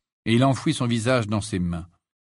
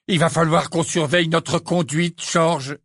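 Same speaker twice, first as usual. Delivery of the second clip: mock fearful; 6 semitones higher, 4 dB louder than usual. A narrator's reading. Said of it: "faisait mine de trembler".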